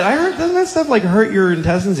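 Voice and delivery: high-pitched protesting patient; worried question. Retold or imitated. Patient asking doctor about the pills, worried and high. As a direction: raise the doubt in a high, anxious pitch.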